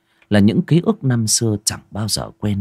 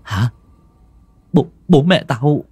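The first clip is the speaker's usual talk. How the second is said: stammering; 3 semitones higher, faster than usual. Acted. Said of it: "Đen lắp bắp".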